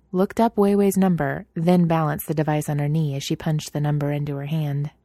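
ordinary delivery, nothing marked

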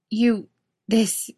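high, slow, stunned disbelief; bewildered. A narrator's reading. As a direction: stammer it out in shock. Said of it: "Misha gets out".

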